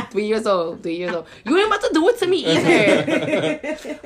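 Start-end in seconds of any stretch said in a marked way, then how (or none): 1.4-4.0 high-pitched playful telling-off; mock scolding. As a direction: put a small child in place, half laughing. Acted scolding of her child.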